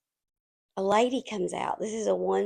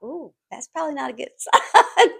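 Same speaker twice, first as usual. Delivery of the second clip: laughing; 6 semitones higher, 7 dB louder than usual. Real, not acted. Reacting to long bathroom wait.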